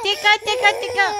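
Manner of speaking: loud playful tickle chant; tickle-tickle sing-song, loud and bright; giggly tickle attack. Real, not acted.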